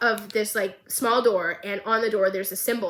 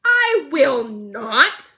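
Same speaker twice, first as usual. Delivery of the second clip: indignant; 3 semitones higher, 6 dB louder, much slower than usual. Retold or imitated. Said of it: "And Caitlyn goes".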